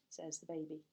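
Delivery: ordinary delivery, nothing marked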